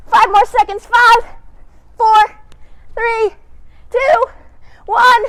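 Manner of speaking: loud, rising countdown; countdown call. Real, not acted. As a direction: count them down with energy. Counting down the workout.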